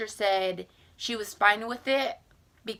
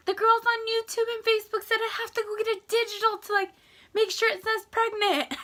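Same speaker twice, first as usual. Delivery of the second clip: excited; 13 semitones higher, faster than usual. Retold or imitated. Excited quote to mom.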